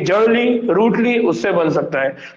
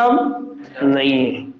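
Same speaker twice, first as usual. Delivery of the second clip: drawn out, lower and slow; 6 semitones lower, much slower than usual. Shown as a demonstration. Teacher explaining ex MLA to students, slow and low. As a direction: let "अब नहीं है" sink slowly and low. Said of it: "पहले MLA था".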